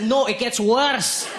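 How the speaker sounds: put-on high, slow, deadpan Scottish accent; Scottish local. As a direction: drag it out, smug Scot. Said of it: "He said".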